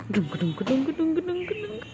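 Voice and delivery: a playful pet call going up; rising sing-song. Real, not acted. (ক্রমশ উঁচু সুরে আদুরে ডাক)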